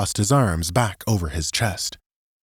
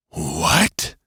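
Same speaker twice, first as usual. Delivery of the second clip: growled; 3 semitones lower than usual. A narrator's reading. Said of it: "Alex growled".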